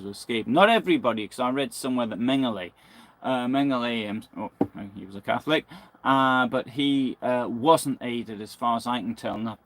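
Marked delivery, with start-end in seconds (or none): none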